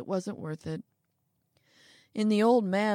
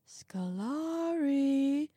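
little man, slow and lightly warning; a touch of warning in the little man's voice; hint of warning; 8 semitones higher, much slower than usual. A narrator's reading. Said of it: "a hint of warning in the little man's voice".

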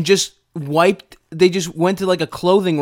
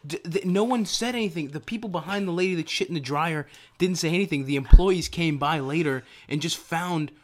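hushed rant; 3 semitones lower, 9 dB quieter than usual. Real, not acted. Lowering voice for sleeping daughter.

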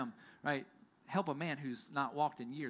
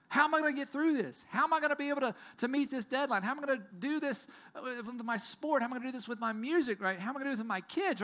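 anxious questions; 7 semitones higher, faster than usual. Retold or imitated. Listener voicing everyday anxieties, high worried rush. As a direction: rattle off worries fast.

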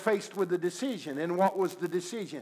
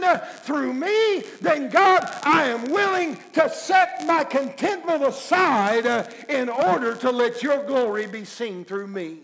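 fervent prayer; 5 semitones higher, 4 dB louder than usual. Acted. Believer's prayer of surrender.

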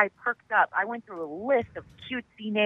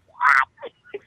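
a sudden high squeak of disbelief; retold disbelief; 3 dB louder than usual. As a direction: jump way up in pitch, stunned. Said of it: "and I was like".

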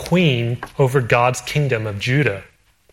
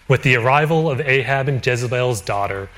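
usual speaking voice

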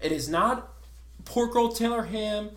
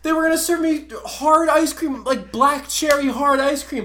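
high, loud, exasperated ranting; angry rant; 8 semitones higher, 11 dB louder than usual. Real, not acted. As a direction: rant it at the top of your voice. I'm so angry.

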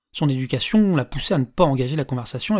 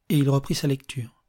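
ordinary delivery, nothing marked

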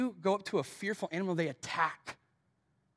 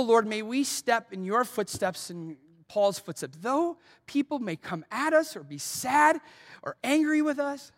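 earnest pastoral prayer with rising fervor; closing prayer; 3 dB louder than usual. Acted. Pastor praying over his congregation at the end of the sermon, earnest and fervent. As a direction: pray it out for the congregation, building in the middle.

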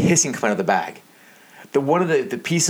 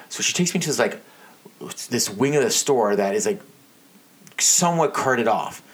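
ordinary talk

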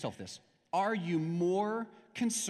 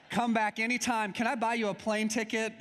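high, gushing mock eagerness; hammed-up eager welcome to a generous guest; eager invitation; 6 semitones higher than usual. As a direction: play it over-keen, pitched up high. Acting eager after gift of money.

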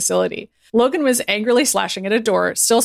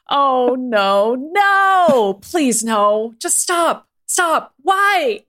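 high, loud plea with drawn-out cries; crying out; 5 semitones higher, 3 dB louder, much slower than usual. A narrator's reading. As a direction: beg them to stop, crying out. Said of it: "Maddie cried out".

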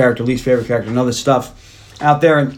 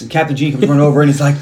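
normal talking voice

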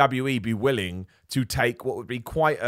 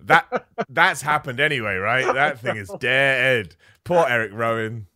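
laughing; 5 dB louder, slower than usual. Real, not acted. Laughing along at the joke.

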